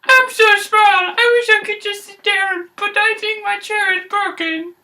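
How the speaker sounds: very high tiny cub voice; baby bear voice. Acted. Baby bear finding his chair broken, very high. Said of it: "the baby bear's like".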